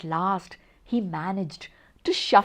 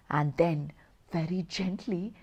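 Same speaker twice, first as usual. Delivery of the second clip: soft, fading; 5 semitones lower, 8 dB quieter than usual. A narrator's reading. Story winding to sleep.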